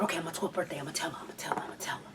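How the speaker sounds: hushed whisper to herself; breathy secret promise; whispered resolve. Retold or imitated. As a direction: whisper it like a scared kid's secret.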